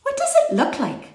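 put-on very high little-girl voice; high-pitched, inquisitive small child; Lindy. A narrator's reading. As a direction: pipe up with a child's curious question. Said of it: "asked Lindy".

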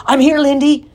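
high, loud rescue shout. A narrator's reading. Ben shouting to his sister as he charges to her rescue. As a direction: charge in yelling to save her. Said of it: "shouted Ben".

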